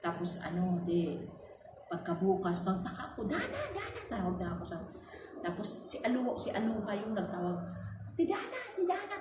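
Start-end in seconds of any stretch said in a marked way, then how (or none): 3.3-4.0 high laughing call of her name. Retold or imitated. Employer's child calls the maid's name from afar, laughing. 8.1-9.2 put-on high child's call, repeated. Young Aluha calls for Ate Dana to bring her ID, high call.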